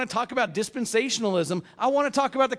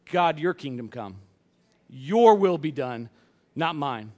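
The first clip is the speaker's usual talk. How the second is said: humble prayer; 7 semitones lower than usual. Pretend.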